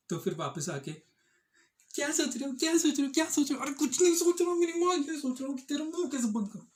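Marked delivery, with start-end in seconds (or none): 1.9-3.6 high, loud, insistent mock nagging; pestering wife act. Acts wife pestering silent man. 3.6-6.7 high, loud mock blow-up; exasperated outburst act. Acts man snapping back.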